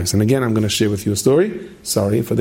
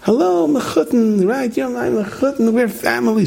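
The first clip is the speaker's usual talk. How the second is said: put-on much higher, slow, friendly old man voice; high sing-song greeting of a confused resident; mock cheerful high old man; imitating a cheerful old man; 13 semitones higher than usual. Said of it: "this man is going".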